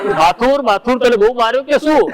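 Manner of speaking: high, teasing mock holler; imitated calling out. Repeating the prank call on request, mock holler. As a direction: re-enact the call high-pitched, without full volume. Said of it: "શું, શું બૂમ પાડી?".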